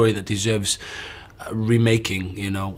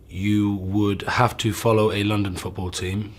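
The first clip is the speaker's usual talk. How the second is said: ordinary talk, nothing marked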